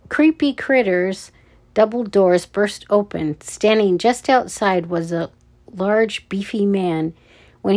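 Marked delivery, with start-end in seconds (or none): none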